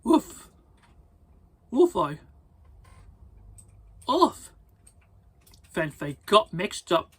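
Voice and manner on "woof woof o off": high loud woofs; barking dog. Acted. Reading the story, barking for the dog. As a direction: yap like a little dog.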